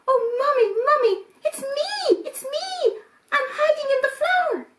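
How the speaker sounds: put-on very high, small child voice; a tiny kid calling from hiding; little kid voice. Little goat calls to Mummy from the flour bag, very high and small. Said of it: "this little voice said".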